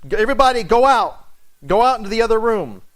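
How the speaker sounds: loud command. Retold or imitated. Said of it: "he's with a loud voice".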